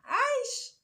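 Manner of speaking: high, sharp Aish; exasperated exclamation. Real, not acted. Scolding herself over the red flags, a high Aish. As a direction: cry out at your own folly.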